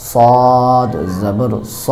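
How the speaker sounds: very slow, স said with a thick, rounded vowel; স stretched, the vowel heavy and close to o; heavy সদ recitation. Shown as a demonstration. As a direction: draw out সদ জবর স like a tajweed teacher. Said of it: "সঠিক উচ্চারণটা হইতেছে".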